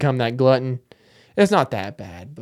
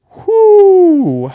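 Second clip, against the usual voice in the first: long, loud, high-pitched whoo; drawn-out falsetto hoot of disbelief; high whoop; 12 dB louder than usual. Acted. Comic reacting to a very early bedtime, high whoop.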